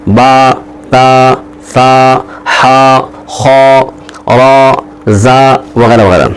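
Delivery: با تا سا ہا خا را زا each stretched to one alif; slow stretched syllables; drawn-out letters. Shown as a demonstration. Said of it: "ایک الف کے برابر کھینچ کر پڑھیں گے".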